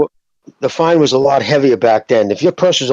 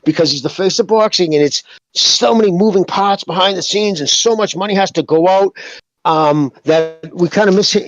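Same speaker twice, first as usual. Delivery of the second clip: higher, sing-song mock corporate excuse; lightly voiced fake apology, higher; mocking the company; 6 semitones higher than usual. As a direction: parody a slippery company spokesman, pitched up. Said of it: "And then suddenly".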